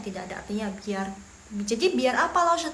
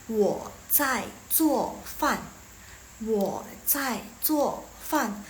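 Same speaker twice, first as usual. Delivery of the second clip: very slow, word by word; slow teaching model; much slower than usual. Shown as a demonstration. Teacher modelling an answer for students, slowly. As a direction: give each syllable its own space.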